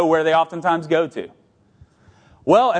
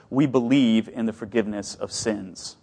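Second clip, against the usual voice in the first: soft mutter; 6 semitones lower, 5 dB quieter than usual. Shown as a demonstration. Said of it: "we need to mutter to ourselves".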